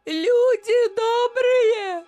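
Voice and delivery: very high, loud, long-drawn cry for help; keen the names out slowly, desperately; screamed wail. A narrator's reading. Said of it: "и закричала".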